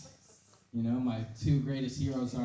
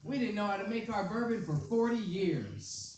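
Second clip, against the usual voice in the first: much higher, very slow proud announcement; mock proud brand voice; 7 semitones higher, slower than usual.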